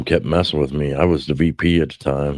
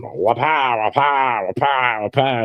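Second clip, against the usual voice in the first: higher, rhythmic punch noises, each falling; punching sound effect; 6 semitones higher, slower than usual. Retold or imitated. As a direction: mimic fists landing, beat by beat. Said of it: "I'm just sitting there going".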